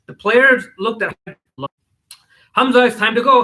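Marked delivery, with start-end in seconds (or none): 2.5-3.4 higher, louder summons; calling out. A narrator's reading. Older sister calls her brother away after the game, calling out. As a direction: call across the court. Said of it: "Hamza's older sister called".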